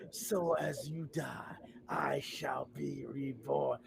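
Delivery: slow, hushed villain whisper. Retold or imitated. Skeletor answers the fan in a slow villain whisper. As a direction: breathe it slowly like a dark lord.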